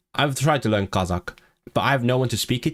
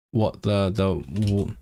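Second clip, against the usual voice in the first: ordinary delivery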